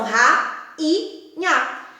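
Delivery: ra, i, nha as three separate syllables with pauses. Shown as a demonstration. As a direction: space out ra, i, nha, each one on its own. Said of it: "As sílabas são". (ra, i, nha, separando bem as sílabas)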